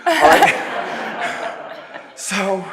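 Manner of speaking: high laughing "all right". Real, not acted. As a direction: laugh it off, amused. Laughs at audience reply.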